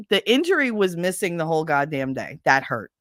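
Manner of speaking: usual speaking voice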